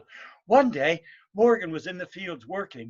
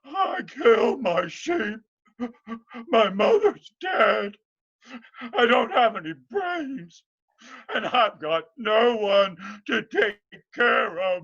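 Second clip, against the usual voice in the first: loud high sobbing wail; blubbering lament; 6 semitones higher, 5 dB louder than usual. Acted. Morgan wailing his woes to the pretty lass. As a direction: howl out your misery.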